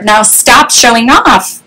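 ordinary delivery

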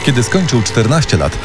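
ordinary talk

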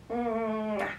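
exaggerated "mwah" smack; playful kiss sound. Carer at kiss time with baby, playful smooch. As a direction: give baby a big audible kiss.

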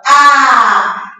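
loud, held open "A"; sound demonstrated. Shown as a demonstration. Teacher showing the first sound, "A" loud and sustained. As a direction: sing out "A" as one long clear vowel. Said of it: "Olha a fala".

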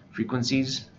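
ordinary talk

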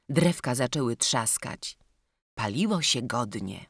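plain reading voice

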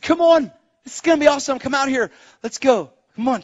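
high, eager coaxing; excited urging. Retold or imitated. Nephew urges his aunt outside, excited. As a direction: hustle her along, thrilled.

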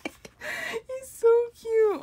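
very high, slow, stretched cute; melting fangirl coo; gushing squeal. Real, not acted. Fan gushing over a photo.

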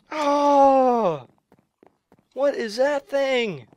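high, drawn-out wail; dismayed wail. Real, not acted. Gamer killed by an unknown thing, wailing in dismay. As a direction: moan it out in dismay.

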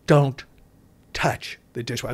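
usual speaking voice

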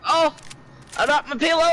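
high dismayed wail. Real, not acted. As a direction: squeak it out in distress. Repeated in dismay.

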